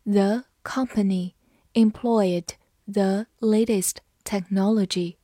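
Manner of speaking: slow, deliberate read-aloud; slow classroom model reading. Shown as a demonstration. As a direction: take each word slowly for learners. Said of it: "好，慢读一遍。".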